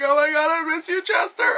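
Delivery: high-pitched pining cry; mock heartbroken wail. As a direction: pitch climbing in a hammed-up lament. Wailing over resemblance to Chester.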